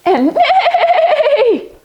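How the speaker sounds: high whinnying neigh; horse neigh. Pretend. Horse neigh in kids yoga.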